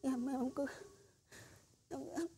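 terrified stammer. Acted. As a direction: stutter it out, barely daring to speak. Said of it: "thế là sợ quá rồi". (lắp bắp khẽ vì quá sợ)

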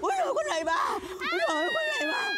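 frantic cries for help. Acted. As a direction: scream for the whole village to come. Terrified shaman crying for help.

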